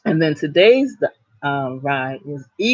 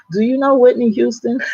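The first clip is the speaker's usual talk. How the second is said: eager question; 8 semitones higher, 4 dB louder than usual. Retold or imitated. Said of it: "And he was like".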